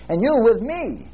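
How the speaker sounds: slow build to a drawn-out "me"; stress on me. Shown as a demonstration. Preacher bringing the verse home, stress on "me". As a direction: lift "me" high and let it fall. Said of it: "Emphasize every word".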